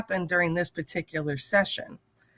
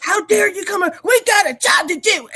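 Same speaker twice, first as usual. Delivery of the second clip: high, loud mock yelling worker; imitating an angry worker, pitched way up and loud; 12 semitones higher, 5 dB louder than usual. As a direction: shriek it like an outraged bully. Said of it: "he started yelling at me".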